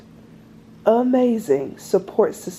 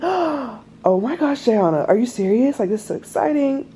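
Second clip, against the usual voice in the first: mock excited friend; 3 semitones higher than usual. People hearing she's pregnant, mock thrilled gushing. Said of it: "They'd be like".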